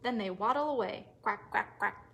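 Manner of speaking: ordinary delivery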